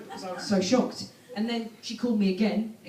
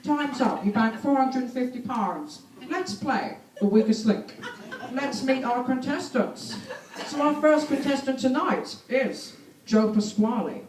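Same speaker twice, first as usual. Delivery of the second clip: stern quiz-host voice, slow and deliberate; Anne Robinson impression; 3 semitones higher than usual. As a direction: play the cold Weakest Link presenter. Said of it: "Anne Robinson".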